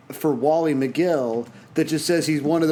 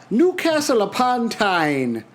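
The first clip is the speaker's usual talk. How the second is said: slow, higher-pitched put-on regional accent; wrong accent for Newcastle; 7 semitones higher, slower than usual. Co-host announcing a music school's town, in a botched regional accent. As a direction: draw out the place name in a mistaken accent. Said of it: "That's the wrong accent".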